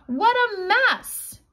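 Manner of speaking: high, slow exclamation; exclaiming. A narrator's reading. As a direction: marvel at the chaos. Narrator exclaims at chaos.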